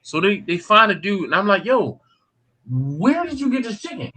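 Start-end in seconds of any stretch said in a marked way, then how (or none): 1.6-4.1 high-pitched, incredulous questioning; retold confrontation. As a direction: act out confronting him, pitched up. Acted confronting of chicken's owner.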